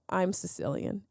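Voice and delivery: ordinary delivery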